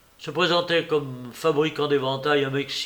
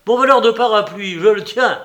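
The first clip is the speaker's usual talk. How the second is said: loud and high, a furious doorman pouncing; irate outburst; 7 semitones higher, 10 dB louder than usual. A narrator's reading. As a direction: bellow it as if catching the thief. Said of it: "L'irascible portier bondit". (éclat furieux et fort)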